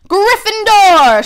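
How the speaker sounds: loud, high, drawn-out call of the house; booming Sorting Hat proclamation; hat shouting. A narrator's reading. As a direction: announce it to the whole hall. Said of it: "shouted the hat".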